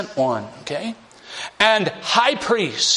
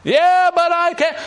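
whiny Moses; 12 semitones higher, 4 dB louder than usual. Acted. Moses whines back.

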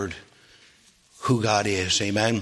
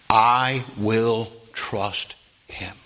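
slow sincere vow; much slower than usual. Acted. Preacher voices Job's trust.

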